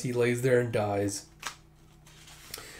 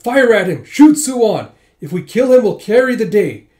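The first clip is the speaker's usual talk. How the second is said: much higher, much louder screamed command; frantic furious shout; 9 semitones higher, 13 dB louder than usual. Acted. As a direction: scream for them to shoot the king. General ordering archers to kill king.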